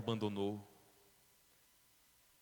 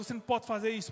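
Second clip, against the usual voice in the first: crying plea; 9 semitones higher, faster than usual. Acted. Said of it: "José chorando".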